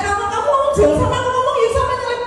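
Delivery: high-pitched imitation of a cross spouse; mock-complain with the pitch pushed up; mildly hammed-up grumbling; retold annoyed scolding. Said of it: "dia marah. Katanya".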